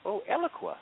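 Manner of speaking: put-on high, bright exclamation in a Peruvian accent, relishing "Eloqua". Bandmate Oscar from Peru saying the company name, Peruvian accent. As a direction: mimic a Peruvian friend savouring the word "Eloqua". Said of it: "he says in his, you know, Peruvian accent".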